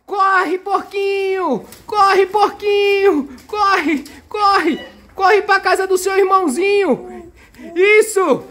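high, excited urging to run; urgent play cheer. Pretend. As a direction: urge the little pig on, playful alarm. Urging the toy pig to flee.

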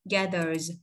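"Gathers" ending in a voiced Z; final Z sound. Shown as a demonstration. Teacher showing learners the S ending, "Gathers" said with final Z. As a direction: let the S at the end of "Gathers" buzz as Z. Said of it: "nó sẽ đọc là âm Z nha".

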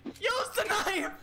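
high yell with every word stretched; slow, high-pitched desperate shout; drawn-out frantic yell. Real, not acted. Repeats the order, drawn out.

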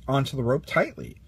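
usual speaking voice

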